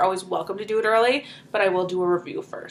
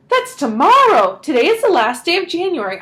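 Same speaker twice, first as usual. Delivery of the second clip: higher and louder, urgent; excited; 7 semitones higher, 8 dB louder than usual. A narrator's reading. Mother tells the family, high and eager. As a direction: press the point eagerly.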